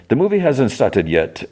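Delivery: lightly voiced affected moviegoer; Trevor Slattery. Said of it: "So it's Trevor Slattery".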